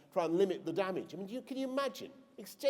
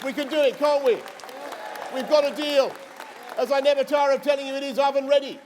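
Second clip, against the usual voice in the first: rousing rally cry; 6 semitones higher, 8 dB louder than usual. Real, not acted.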